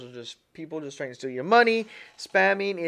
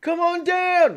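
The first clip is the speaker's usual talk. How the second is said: much higher and louder, game-show hype; mock spam prize announcer; 14 semitones higher, 13 dB louder than usual. Streamer mocking a spam email with a loud, high game-show announcer voice. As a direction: belt it out like a cheesy prize announcer. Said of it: "it says".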